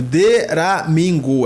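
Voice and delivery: "Deramingo" slowly, syllable by syllable; careful pronunciation. Shown as a demonstration. Confirming the Korean word for viewers, "Deramingo" slow and separated. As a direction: stretch out each syllable of "Deramingo". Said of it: "Eu acho que é isso mesmo".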